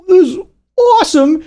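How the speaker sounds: high-pitched thrilled exclamation; awestruck reaction. Retold or imitated. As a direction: marvel at it, voice way up high. Quotes own thrilled reaction to scenes.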